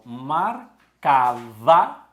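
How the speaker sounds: MARCAVA drawn out loud, syllable by syllable; past-tense emphasis. Shown as a demonstration. Teacher stressing to the class it no longer marks, emphatic MARCAVA. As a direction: stretch and punch "marcava". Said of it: "porque não marca mais".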